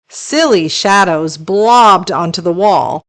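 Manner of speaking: ordinary delivery, nothing marked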